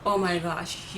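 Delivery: ordinary delivery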